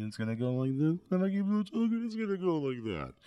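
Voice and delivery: siren-like rise and fall on the words; flanger demo. Shown as a demonstration. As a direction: pitch climbing very high, then sliding down.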